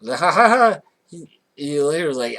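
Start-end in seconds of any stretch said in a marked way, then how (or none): 0.1-0.8 loud, high hammed-up laugh; fake laugh. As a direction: laugh it off like a clown. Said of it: "plays it off like".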